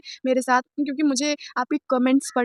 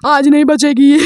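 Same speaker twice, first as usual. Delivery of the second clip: angry threat; 10 dB louder than usual. Retold or imitated. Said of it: "वो मुझे ऐसे ढूंढ रहा था".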